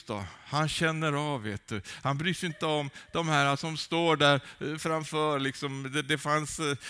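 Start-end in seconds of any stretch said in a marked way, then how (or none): none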